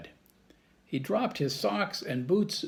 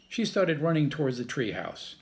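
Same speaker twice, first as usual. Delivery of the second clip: ordinary delivery, nothing marked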